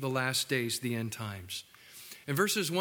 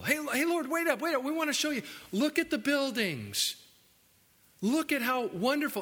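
high, eager calling out, lightly mocked; eager disciples; 8 semitones higher than usual. Disciples calling after an angry Jesus, high and eager. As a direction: tug at his sleeve, all excited to show off. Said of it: "his disciples came up to show him".

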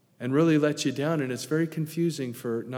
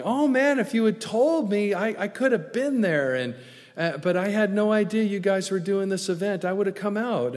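higher, louder mock-regretful friend; dismayed exclamation; 7 semitones higher, 4 dB louder than usual. Pastor friend hearing about the event too late, retold with dismay. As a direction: exclaim it as a let-down friend, pitched up.

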